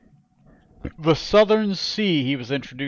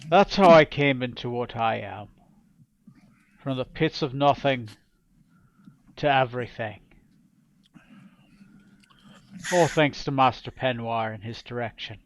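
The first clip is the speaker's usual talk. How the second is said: menacing pirate king. Acted. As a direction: tell your rise to power like a cold tyrant savoring every cut. GM voices the king's tale.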